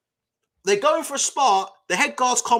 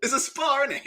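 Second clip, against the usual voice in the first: calming; 4 semitones higher than usual. Retold or imitated. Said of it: "I'm like".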